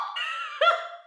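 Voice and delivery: very high-pitched laugh; real laugh. Real, not acted. Speaker laughing at own retold scream, high laugh.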